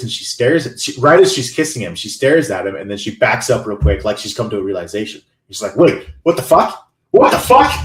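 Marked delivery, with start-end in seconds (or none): 5.8-7.7 acted alarm, rising sharply higher and louder; imitated scared shock. Imitating Nikki backing away mid-kiss, suddenly scared. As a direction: build from startled question to high, loud disbelief. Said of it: "she's really scared".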